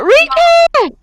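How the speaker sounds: high, loud screaming. Acted. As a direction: scream for the sack to be handed over. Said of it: "I scream out".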